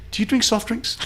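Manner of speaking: high-pitched mock South African questioning; imitated South African accent. Part owner quizzing the job candidate, South African accent. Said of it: "He does really good voices".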